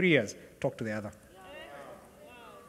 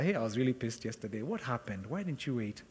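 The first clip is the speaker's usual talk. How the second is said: a husband apologizing, softly asking why; soft apology; 4 semitones lower, 8 dB quieter than usual. Retold or imitated. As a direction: lower and softer, a gentle question. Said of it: "I told her".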